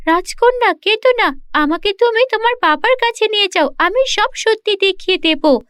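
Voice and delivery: kindly magic mirror consoling; comforting mirror. Acted. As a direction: reassure the princess gently. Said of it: "আয়না বলল".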